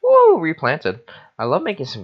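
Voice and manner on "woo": a high, loud, happy whoop; excited whoop. Real, not acted. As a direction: whoop with glee. Excited whoop while building.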